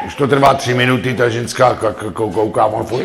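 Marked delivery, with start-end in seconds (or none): none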